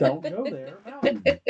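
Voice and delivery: loud, high-pitched sassy comeback. Acted. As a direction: say it with way too much sass. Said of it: "give it that much sass".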